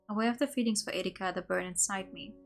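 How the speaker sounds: ordinary delivery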